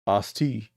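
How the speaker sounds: Asti said as AH-stee, two syllables; Asti, AH-stee, stress on the first syllable; correct pronunciation. Shown as a demonstration. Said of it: "So I'm just calling Asti".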